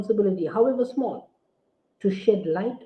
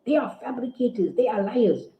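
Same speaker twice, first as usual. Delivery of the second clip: mocking opponent's quote; 5 semitones higher than usual. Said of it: "They tell you".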